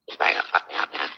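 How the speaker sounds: hushed aside, whispered low; whispering. Real, not acted. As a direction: whisper it so he won't hear. Aside while someone approaches.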